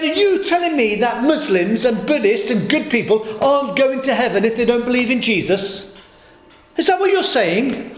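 high, affronted posh questioning; indignant posh lady. Retold or imitated. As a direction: mimic a posh lady demanding an answer. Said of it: "Very posh lady".

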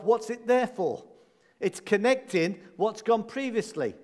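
ordinary delivery

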